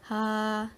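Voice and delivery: "ha" held on one even, level tone. Shown as a demonstration. Student presenting an example of level tone, "ha" held level. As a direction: say "ha" drawn out with no rise or fall. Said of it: "ada yang mendatar seperti".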